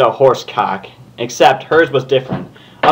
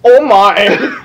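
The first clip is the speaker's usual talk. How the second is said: hammed-up posh British Rarity, higher and louder; stupid British accent; 7 semitones higher, 5 dB louder than usual. Reading Rarity's line to a friend in a silly British accent. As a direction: camp British gasp. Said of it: "a really stupid British one for Rarity".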